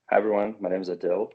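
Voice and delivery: ordinary talk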